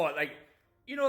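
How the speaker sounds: ordinary talk, nothing marked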